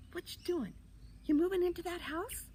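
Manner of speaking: put-on high squeaky kid shouting down; loud, high, nosy question. A narrator's reading. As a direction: pipe up high from the treetop. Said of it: "A squeaky voice from somewhere in the sky yelled".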